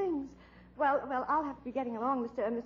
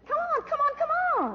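impatient urging; 11 semitones higher than usual. Acted. Pressing informant for answers.

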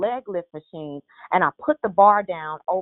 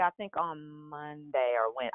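ordinary delivery

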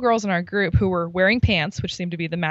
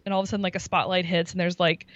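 normal talking voice

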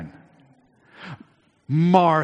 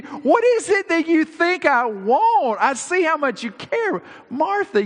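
high, urgent sermon voice; impassioned preaching; 4 dB louder than usual. Acted. Jesus pleading with Martha, high and fervent. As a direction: preach it with rising fervour, higher.

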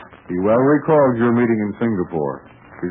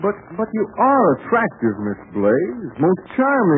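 flattering; 8 semitones higher than usual. Acted. Pat flattering Miss Blaze.

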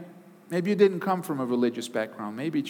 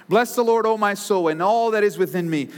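scripture reading; 5 semitones higher, 3 dB louder than usual. Acted. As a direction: proclaim the psalm with fervor. Reads Psalm 103 aloud.

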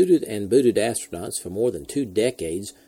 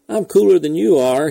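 loud, higher-pitched narrated bark; irritable bark; 7 semitones higher, 8 dB louder than usual. A narrator's reading. Irritable astronaut radioing after hours on his back, barking. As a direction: snap it out, raised and impatient. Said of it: "he barked".

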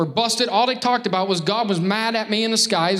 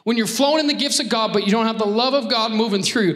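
ordinary delivery

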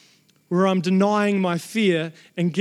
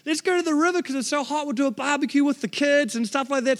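higher, bright idea to celebrate; eager to celebrate; 5 semitones higher than usual. Retold or imitated. Husband proposing a river barbecue, eager and higher. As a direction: pitch it up, keen to party. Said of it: "I'm like".

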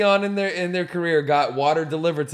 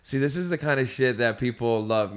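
usual speaking voice

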